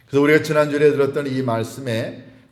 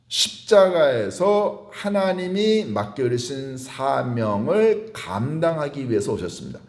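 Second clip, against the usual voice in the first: ordinary talk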